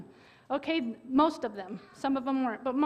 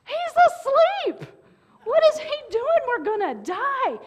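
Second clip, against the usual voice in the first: high, loud alarmed cry; shrill panic, he's asleep; frantic disciple; 10 semitones higher, 8 dB louder than usual. Retold or imitated. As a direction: wake the others in disbelief. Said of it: "one of them goes".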